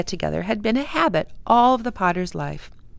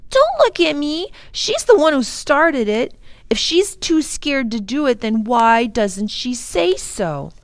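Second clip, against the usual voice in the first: put-on boy Tom; 7 semitones higher, 7 dB louder than usual. A narrator's reading. Tom voiced, defensive.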